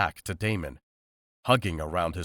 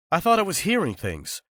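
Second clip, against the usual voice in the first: exclaimed; 11 semitones higher, 5 dB louder than usual. A narrator's reading. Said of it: "Jason exclaimed".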